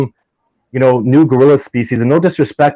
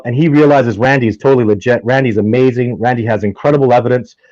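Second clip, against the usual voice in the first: ordinary delivery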